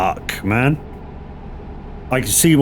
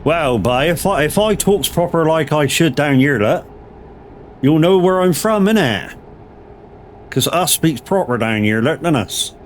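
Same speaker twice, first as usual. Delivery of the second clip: put-on broad West Country accent; 2 semitones higher than usual. Streamer answers chat with a broad West Country accent. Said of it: "same accent about thirty minutes out".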